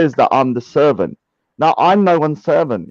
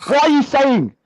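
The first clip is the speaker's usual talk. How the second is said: much higher, hammed-up indignant squeal; mock outraged whine; 10 semitones higher than usual. Teased about whining, he shoots back in mock outrage. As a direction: squeal it like a wounded accuser. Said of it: "you're either accusing someone or you're whining".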